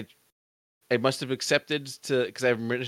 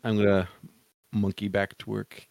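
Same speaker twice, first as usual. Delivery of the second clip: ordinary talk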